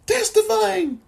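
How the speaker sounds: cracking, nearly gone voice. Real, not acted. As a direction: voice breaks on a high cry. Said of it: "I did lose my voice just then".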